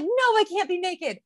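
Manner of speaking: high-pitched playful refusal; mock protest. Retold protest to midwives.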